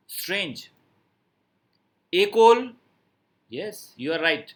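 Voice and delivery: usual speaking voice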